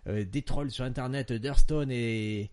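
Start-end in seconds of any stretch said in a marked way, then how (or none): none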